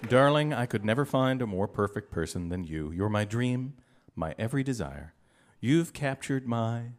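low, tender declaration of love; romantic lover. Acted. Romantic clue acted as a lover.

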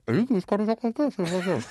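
high muffled voice through fingers; hand over mouth. Shown as a demonstration. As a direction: talk into your cupped hand, high. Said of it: "put it over your mouth like this".

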